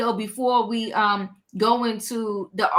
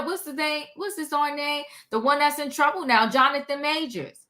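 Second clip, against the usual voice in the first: ordinary talk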